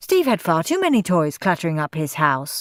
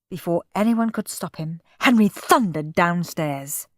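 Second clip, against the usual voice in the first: ordinary delivery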